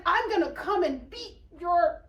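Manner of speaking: high, loud acted threat; shouted threat. Woman threatening the girl while leaving, shouting angrily. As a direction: yell back in mock fury, cutting off.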